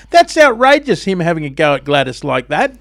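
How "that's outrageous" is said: high, loud indignant cry; exaggerated indignation; outraged exclamation. Real, not acted. Re-enacting outrage at premier.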